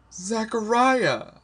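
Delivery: high, loud, drawn-out outburst; slow anguished cry of a name; bursting out. A narrator's reading. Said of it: "I burst out".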